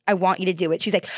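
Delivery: normal talking voice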